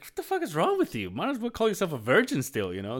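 high, scornful teasing; squeaky mock-scornful friend; mock disgust. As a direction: rag on him in a high voice. Buddy's mock outrage continues.

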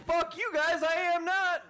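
mock angry retort. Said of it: "I'd be like".

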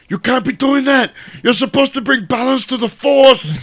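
loud, high Austrian action-star parody; Arnold impression. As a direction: cry out in anguish as an over-the-top action star. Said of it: "And Arnold was like".

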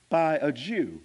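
ordinary delivery

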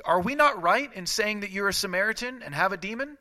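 high, loud mocking question; sarcastic. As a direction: sneer the question. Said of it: "it's so sarcastic".

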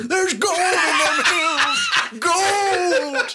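high, slow laughing shout; prospector voice. Retold or imitated. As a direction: cackle it like a gold-rush prospector. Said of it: "sounding like a prospector".

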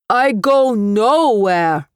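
slow, muffled through the door; muffled behind a door. A narrator's reading. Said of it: "his voice muffled".

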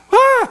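sudden very high, loud cry; mock newborn yelp. Pastor imitating a baby's first cry for the congregation, mock yelp. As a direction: squeal like a slapped newborn.

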